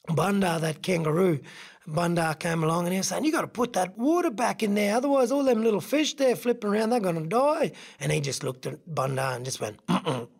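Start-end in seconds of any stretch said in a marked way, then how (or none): 3.2-7.7 higher-pitched urgent kangaroo scolding; urgent pleading. Acted. 9.9-10.3 short closed-mouth "mm-mm"; closed-mouth refusal. Acted. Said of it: "wouldn't even open his mouth".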